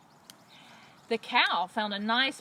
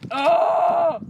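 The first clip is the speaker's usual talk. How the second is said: loud, higher "Oh!" cry; loud scream; 6 semitones higher, 11 dB louder than usual. Acted. Robbers screaming as they flee, loud cry. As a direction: cry out in storybook fright.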